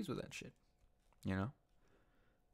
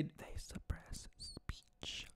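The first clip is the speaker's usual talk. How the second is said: slow, hushed whisper; slow conspiratorial whisper; dramatic whisper; 9 dB quieter, much slower than usual. Acted. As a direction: lean in and whisper it like a secret. Repeated whispered punchline.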